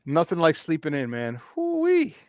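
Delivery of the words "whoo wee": gleeful whoop. Real, not acted. Celebrating sleeping in.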